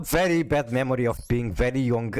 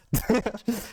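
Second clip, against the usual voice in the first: high short laugh at own loss; rueful laugh; 10 semitones higher than usual. Real, not acted. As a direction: laugh it off after losing. Laughs after losing.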